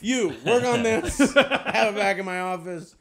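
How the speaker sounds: loud, laughing boss command; mock bossy order. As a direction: bark it like a joke boss delegating. Mock boss handing jokes to underlings.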